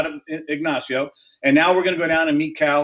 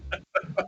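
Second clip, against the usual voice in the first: soft laugh; 3 semitones higher, 9 dB quieter than usual. Real, not acted. Laughs at end of his story.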